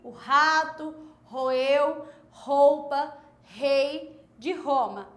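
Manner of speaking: rato, roeu, roupa, rei de Roma, each word separated, initial R guttural h; R sound demo. Shown as a demonstration. Teacher isolating the twister's R words for pupils. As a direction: pick out each word and hit its throaty R. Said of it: "vai ter esse som de rei".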